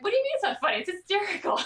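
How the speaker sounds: high, laughing mock outrage; mock indignant. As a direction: act offended that anyone missed the joke. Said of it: "I'm like".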